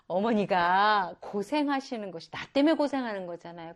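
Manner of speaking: normal talking voice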